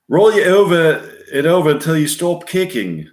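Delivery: attempted Australian accent, pitched high; serious storytelling in a put-on Australian accent; Australian impression that slips toward English; Paul Hogan. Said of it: "with Australian accent, speaking seriously and quietly".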